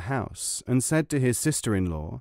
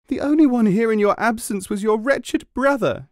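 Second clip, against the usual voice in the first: higher and louder, wailing accusation; crying out; 11 semitones higher, 5 dB louder than usual. A narrator's reading. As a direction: cry it out like a wronged wife. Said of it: "she cried".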